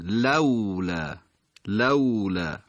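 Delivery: لولا said slowly, the silent waw drawn out clearly; slow careful recitation of لولا; Quranic recitation demo. Shown as a demonstration. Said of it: "نوع خواندن این واو ساکن".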